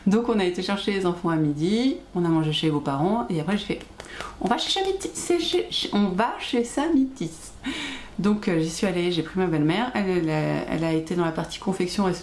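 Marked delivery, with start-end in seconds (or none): 4.4-7.3 high-pitched, eager self-quote, tripping over the shop name; excited announcement. Retold or imitated. Telling viewers how she decided on the shop trip, excited. Acts out her sudden decision to go.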